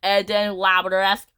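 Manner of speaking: usual speaking voice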